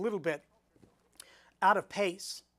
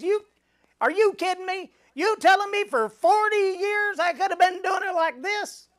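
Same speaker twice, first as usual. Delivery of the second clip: put-on Southern accent; 11 semitones higher, 8 dB louder than usual. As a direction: imitate the Southern YouTube guy, amazed and loud. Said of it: "He's got a real Southern accent".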